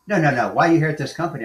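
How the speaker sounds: mock the boss pushing the point; insistent manager.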